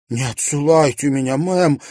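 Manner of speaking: high, loud desperate plea; pleading. A narrator's reading. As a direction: beg not to be sent away. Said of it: "умоляюще проговорила Луиза". (умоляюще, высоко и громко)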